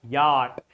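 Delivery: yacht said as "yaat"; correct pronunciation. Shown as a demonstration. Teacher models the word from the alphabet, yacht without a CH sound. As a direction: keep C and H silent in yacht. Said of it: "not yacht".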